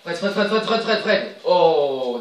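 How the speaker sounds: loud, high, rapid-fire name repeats; urgent calling. Real, not acted. Gamer urging Fred on during an attack, loud excited calling. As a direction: urge the striker on, ending in a loud "Oh".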